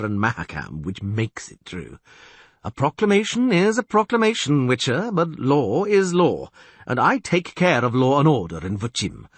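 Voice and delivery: stern castellan. Acted. Castellan lecturing on law.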